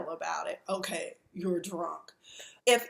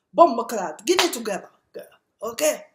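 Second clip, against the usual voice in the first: imitating Spice's outburst; 2 semitones higher, 8 dB louder than usual. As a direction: yell at her to get it together. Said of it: "Spice like".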